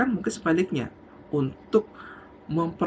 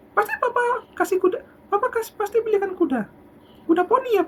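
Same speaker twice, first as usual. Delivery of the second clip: put-on eager child voice. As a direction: plead like a child sure of a pony. Child pleading for pony.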